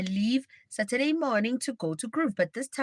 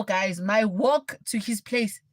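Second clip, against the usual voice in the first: ordinary talk, nothing marked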